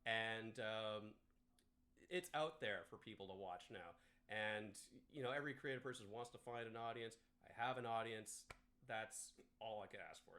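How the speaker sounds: ordinary talk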